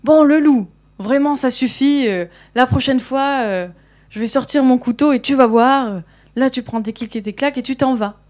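angry scolding. Acted. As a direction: lose patience and shout the wolf away, hammed-up. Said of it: "elle commence à s'énerver encore".

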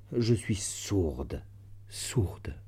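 slow, hushed despair sinking to a whisper; despairing confession. A narrator's reading. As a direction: draw it out, then whisper the last word. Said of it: "amère et désespérée". (aveu désespéré, lent, fini dans un souffle)

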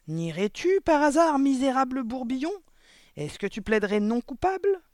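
loud, high accusing questions; outraged, rising cross-examination; furious interrogation. A narrator's reading. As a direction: challenge the culprit at full voice. Raging headmistress accuses the boy.

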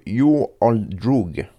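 slow, drawn-out try at an American accent on the name; clumsy American accent. Shown as a demonstration. French radio host names the rapper, slow mock American accent. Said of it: "mon accent est trop pourri".

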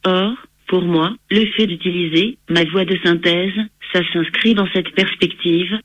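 synthetic computer voice reading text; text to speech voice. Real, not acted. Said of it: "J'utilise un logiciel de synthèse vocale".

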